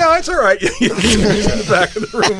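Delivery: high, laughing brush-off; lightly hammed-up no; playful high wave-off; mock breezy dismissal. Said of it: "You go".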